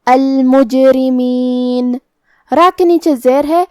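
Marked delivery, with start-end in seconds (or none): 0.1-2.0 slowly chanted المجرمین with an echoing bounce on the jeem; qalqalah on jeem. Shown as a demonstration. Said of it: "جیم کو ہلاتے ہوئے". (المجرمین میں جیم کو ہلا کر تلاوت)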